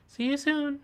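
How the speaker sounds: high, gleeful doll voice; creepy talking doll. Acted. Doll taunts as she flees.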